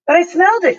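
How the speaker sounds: put-on high and loud, protesting boy; excited cry. A narrator's reading. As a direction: insist it, voice jumping up. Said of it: "cried Tom".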